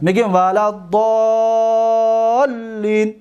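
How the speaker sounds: ولا ضالین drawn out slowly with long madd; slow recitation. Shown as a demonstration. Teacher shows permitted madd, slow recitation. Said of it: "پس این جایز است".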